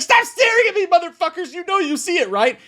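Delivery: high, loud mock scream; screaming at the guests. Woman screaming at the party guests, hammed-up high scream. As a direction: rage at everyone staring at you. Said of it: "she starts screaming".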